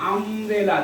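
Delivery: normal talking voice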